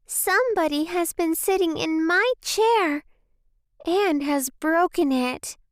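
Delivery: squeaky little bear, pitched high; small squeaky baby voice. A narrator's reading. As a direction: pipe up like a tiny bear cub. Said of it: "said in a small, squeaky baby voice".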